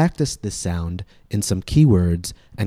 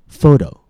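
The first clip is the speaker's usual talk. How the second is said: "Photo" with a long, higher first vowel; stress on first syllable; 4 semitones higher, 5 dB louder than usual. Shown as a demonstration. Teacher showing where stress falls, first syllable of "Photo" long and high. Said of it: "the vowel is longer and the pitch is higher".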